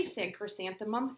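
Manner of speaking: ordinary delivery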